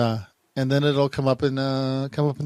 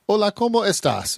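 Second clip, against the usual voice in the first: lightly mimicked app voice, a bit higher; imitating the app; 7 semitones higher than usual. Repeating the app's greeting for viewers, mildly mimicked. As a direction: copy the phone's Spanish lightly. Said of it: "it says".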